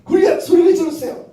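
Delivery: high, loud joking giant call; teasing the kid playing Goliath in a booming voice; mock Goliath call. Teacher calls out as Goliath to the children, mock giant call. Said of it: "골리앗의 소리가 들렸어요".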